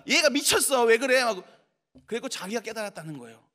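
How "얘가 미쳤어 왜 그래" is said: louder, higher mock maternal scolding; exasperated scolding. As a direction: imitate a mother loudly telling off her child. Mother scolding daughters, acted. (야단치듯 큰 목소리로)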